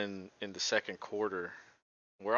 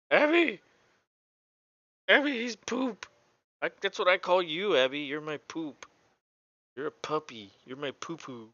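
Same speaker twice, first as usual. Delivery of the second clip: much higher sing-song pet voice; 6 semitones higher than usual. Real, not acted. Talking to his dog Abby in baby talk. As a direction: coo at the dog in a high voice.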